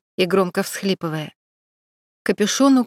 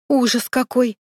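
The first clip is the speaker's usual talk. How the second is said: exclaiming; 4 semitones higher than usual. A narrator's reading. Makeup artist Lilya exclaims in horror at the news. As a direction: throw out the words in shock. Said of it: "воскликнула".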